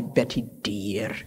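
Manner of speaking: softly imitated Scottish endearment; Scottish accent. Recalling what she always called me, light Scottish accent. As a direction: quote her pet name with a light Scottish lilt. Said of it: "in her wonderful Scottish accent".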